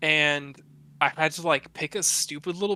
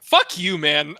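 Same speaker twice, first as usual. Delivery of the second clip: exasperated outburst; 3 semitones higher, 8 dB louder, slower than usual. Retold or imitated. Dad retelling snapping at his baby during booger removal, loud. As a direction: fire back at the angry baby, half-joking. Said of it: "I was like".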